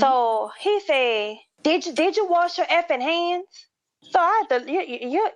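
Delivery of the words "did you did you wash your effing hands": high mock yell at the worker; imitated yell. Customer yelling across the counter at the cashier, mock yell. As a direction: retell him barking the question much higher. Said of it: "when somebody yell at you".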